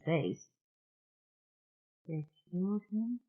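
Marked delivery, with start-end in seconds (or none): 2.1-3.2 high, very soft, stunned question; whisper. A narrator's reading. Mona asks about Aaron's death, whispering. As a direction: ask it in a small hushed voice. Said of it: "Mona asked in a whisper".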